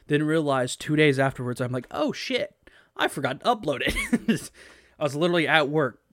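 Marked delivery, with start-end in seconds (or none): 1.9-4.5 high, loud shocked exclamation; sudden panicked realization; startled realization. Retold or imitated. Retold realizing mistake.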